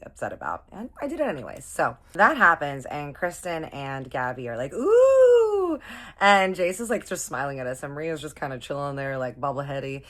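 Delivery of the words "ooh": high, loud drawn-out "oooh"; teasing ooh. Retold or imitated. Kristen and Gabby react to the smoke kiss, teasing ooh. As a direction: tease them with a swooping, high-pitched ooh.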